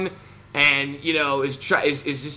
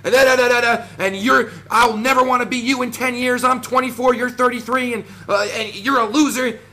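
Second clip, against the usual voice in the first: mocking yell; 7 semitones higher than usual. Mocking a rival vlogger's rant, high mock yell. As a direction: ham up a rival's yelling rant in a higher voice. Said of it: "talking to the top of his lungs like".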